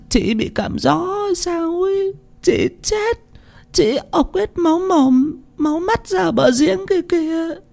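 sobbing. A narrator's reading. Tân describing Mùi's bloody death, wailing sobs. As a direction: weep out the horror. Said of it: "khóc nấc lên".

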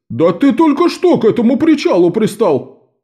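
high, indignant merchant protest; outraged objection. A narrator's reading. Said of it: "возмутился торговец".

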